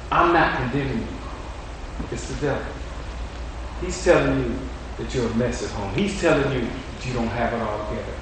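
soft, gentle voice of comfort; tender reassurance. Acted. God comforting weary Christians, soft and tender. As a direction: speak softly as God consoling the weary.

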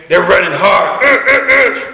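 high, strained mock huffing of tired runners; imitated panting kids. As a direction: puff it out like a kid out of breath. Coach imitating kids slowing down.

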